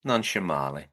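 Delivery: put-on low, mild Italian-accented aside; softly put-on Southern Italian accent; mock Southern Italian accent. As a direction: Englishman attempting Italian, pitched low. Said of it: "most perfect Southern Italian accent".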